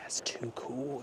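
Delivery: hushed awed whisper. Real, not acted. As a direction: breathe it out in awe. Quiet awe at the view.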